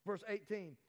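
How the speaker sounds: ordinary talk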